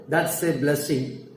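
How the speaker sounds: ordinary talk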